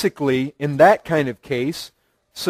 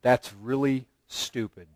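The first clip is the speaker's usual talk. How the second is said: low and much softer, flat contempt; mild mock scorn from a wife; scornful put-down; 6 semitones lower, 9 dB quieter than usual. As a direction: dismiss his idea coldly. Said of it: "looks and says".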